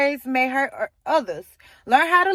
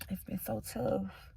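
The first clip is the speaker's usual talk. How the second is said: slow, breathy whisper; real hushed whisper; 4 semitones lower, 16 dB quieter, slower than usual. Real, not acted. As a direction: confide the hardship softly.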